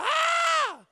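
loud, high-pitched whoop; excited scream. Acted. Excited about filming for followers.

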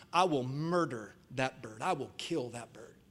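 low, soft, deliberate menace; hushed comic death threat; quiet threat. Acted. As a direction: threaten under your voice, mock-deadly. Lady threatens the bird to manager.

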